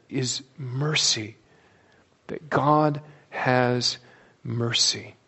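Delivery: mercy said slow and soft, drawn out; slow soft emphasis. Real, not acted. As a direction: lean quietly on mercy. Key word of the sermon.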